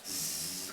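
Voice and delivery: long hissed "zzz" of a current; imitated buzzing. Preacher shows the anointing entering his leg, buzzing hiss. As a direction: make the sound of a shock running in.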